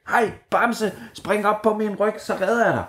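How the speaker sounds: high, chirpy little bird voice; bright tiny hero voice; brave blue tit. A narrator's reading. The blue tit calls Bamse to hop on, high and eager.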